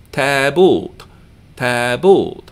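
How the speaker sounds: Tabult with both vowels drawn out; two long syllables. Shown as a demonstration. Teacher telling learners to learn that, long-voweled tabult. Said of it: "here we have two long syllables".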